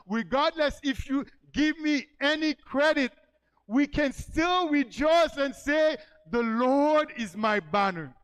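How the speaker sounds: loud, high and slow, building to a shout; preaching at full force. Acted. Pastor to congregation, loud slow preaching shout. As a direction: proclaim it to the whole church, drawing the words out.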